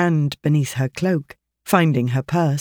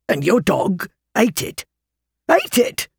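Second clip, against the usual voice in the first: the repeat leaps far higher in outrage; outraged disbelief; 5 semitones higher than usual. Acted. Stranger rails at Alice that her dog ate it, outraged. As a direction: cry out the second ate it in disbelief.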